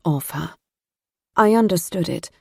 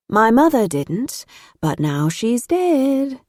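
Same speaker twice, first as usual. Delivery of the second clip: higher, slow and lilting; sing-song voice; 6 semitones higher, slower than usual. A narrator's reading. Matilda to her friends about her mother, slow sing-song. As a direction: sing it out lightly, slowly. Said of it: "in a sing-song voice".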